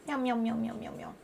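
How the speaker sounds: soft cat meows; quiet mock cat meows; meowing. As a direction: meow softly back at the cat.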